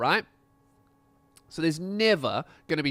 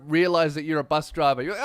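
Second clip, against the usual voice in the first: ordinary delivery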